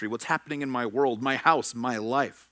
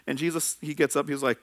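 ordinary talk, nothing marked

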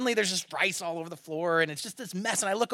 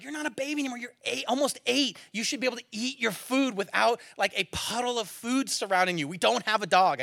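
frustrated scolding; 7 semitones higher than usual. Retold or imitated. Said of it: "And I'm getting frustrated".

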